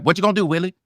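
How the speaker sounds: throwaway, don't-care. Shown as a demonstration. Actor at an audition wanting it over with, throwaway. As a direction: toss the line off like you don't give a damn. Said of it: "I don't give a fuck".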